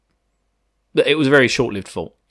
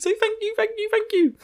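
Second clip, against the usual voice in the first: grateful; 5 dB quieter than usual. Real, not acted. Repeated thanks high and soft.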